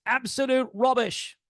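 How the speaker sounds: put-on British voice; British accent. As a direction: quote him as if suddenly British. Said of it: "who suddenly became British".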